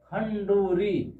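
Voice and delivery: खंडूरी said slowly with an r sound; slow, deliberate खंडूरी with r; careful pronunciation. Shown as a demonstration. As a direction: spell out खंडूरी slowly for the class. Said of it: "हम खंडूरी बोलते हैं".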